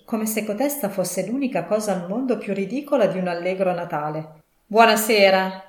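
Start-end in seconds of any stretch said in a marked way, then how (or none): 4.6-5.6 loud, firm goodbye; curt dismissal. A narrator's reading. Scrooge ends the talk with his nephew, loudly dismissive. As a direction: cut the visitor off loudly.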